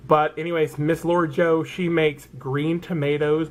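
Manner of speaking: usual speaking voice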